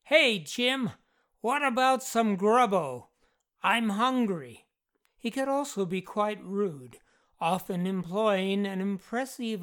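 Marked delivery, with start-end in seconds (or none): 0.0-4.6 raised, higher-pitched demanding call. A narrator's reading. Jeff wants food from Jim, calling out loudly. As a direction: call out for food across the house. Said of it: "He would frequently call out".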